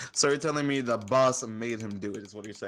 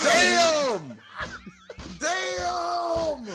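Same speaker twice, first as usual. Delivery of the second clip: much higher, loud shocked cry; stunned shout; 5 dB louder than usual. Real, not acted. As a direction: yell it out in disbelief. Stunned reaction, shouted exclamations.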